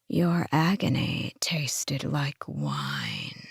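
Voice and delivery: very slow, low, soft creature voice; sinister murmur. A narrator's reading. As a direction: murmur it low, savoring each word. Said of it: "he murmured".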